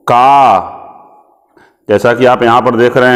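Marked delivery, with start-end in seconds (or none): 0.1-0.7 का said with a long open aa after k; clear syllable demo. Shown as a demonstration. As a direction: model का slowly for children, long aa. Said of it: "क में जब आ की मात्रा लगाते हैं तो क्या पढ़ते हैं इसको?".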